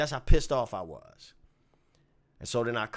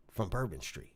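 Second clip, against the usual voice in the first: ordinary talk, nothing marked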